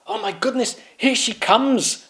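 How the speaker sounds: alarmed at her approach; panicked knights. Acted. Knights panicking to each other as the Queen approaches, high.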